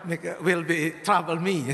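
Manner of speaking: ordinary talk, nothing marked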